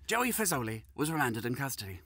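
ordinary talk, nothing marked

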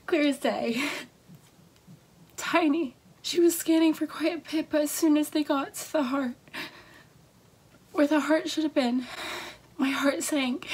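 crying as she relives the scan; real crying. Real, not acted. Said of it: "sorry, I'm trying to get myself together".

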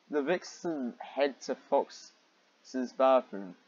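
drawn-out, slow read. Real, not acted. Reader stalling as the bath scene starts, slowly. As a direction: drag each word out.

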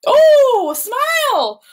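a high, loud, thrilled "oh"; real happy surprise at a drawing; delighted surprise. Real, not acted. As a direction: gasp of delight at the smile. Child shows a smiling monster.

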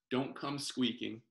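ordinary delivery

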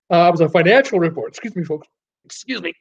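words stretched by a yawn. Real, not acted. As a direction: talk through a big yawn. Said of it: "Ah, just yawning here".

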